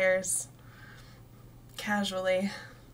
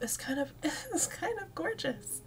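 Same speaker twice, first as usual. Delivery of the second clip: moved, laughing teary; 8 semitones higher, 4 dB quieter than usual. Real, not acted. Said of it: "I'm tearing up".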